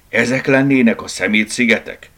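puzzled amazement; wondering. Acted. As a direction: ask it in open wonder. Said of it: "csodálkozott Borneo".

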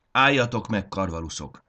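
loud, high call to halt the flock; shouted command. Acted. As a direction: bark the order, head thrown back. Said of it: "kiáltotta hátravetett fejjel".

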